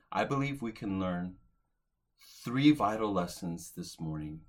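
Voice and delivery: ordinary delivery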